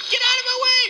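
frantic gamer protest; playful panic. Pretend. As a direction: yelp as if being mobbed, half-joking. Zombies swarm him in game.

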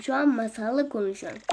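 normal talking voice

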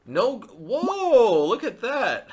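sudden high, loud whoop breaking into a laugh; stunned whoop. Real, not acted. Streamer shouting to chat after a surprise mate, in delighted shock.

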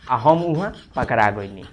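ordinary delivery

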